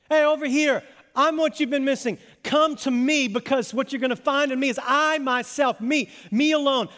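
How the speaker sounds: high, insistent summons; calling out. Acted. Jesus to those missing him, calling out insistently.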